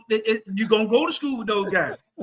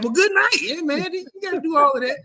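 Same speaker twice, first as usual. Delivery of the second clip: sarcastic retort; 11 semitones higher than usual. Retold or imitated. Mocking the cousin's call.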